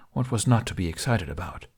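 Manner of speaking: usual speaking voice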